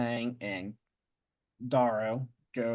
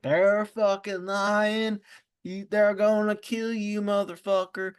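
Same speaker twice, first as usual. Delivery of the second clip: Antonia's voice; 8 semitones higher than usual. Retold or imitated. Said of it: "That's exactly how Antonia talks".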